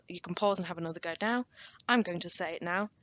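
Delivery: ordinary talk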